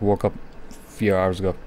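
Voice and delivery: ordinary talk, nothing marked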